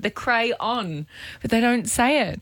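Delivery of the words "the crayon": crayon with a full 'on' at the end; the 'on' sounded. Shown as a demonstration. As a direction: say crayon in two parts, 'cray-on'. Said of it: "say the on bit".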